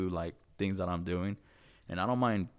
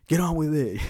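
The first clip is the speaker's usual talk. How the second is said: loud, higher urging to hurry; impatient heckle, pitched up; mock impatience; 8 semitones higher, 6 dB louder than usual. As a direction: push it out, exasperated in jest. Mock impatience with the slow playback.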